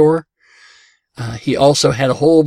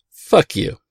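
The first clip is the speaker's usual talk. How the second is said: a lightly voiced crow; 4 semitones higher than usual. Crow answers the friendly man, lightly voiced crow. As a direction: squawk it out like a crow. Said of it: "the crow looks up and goes".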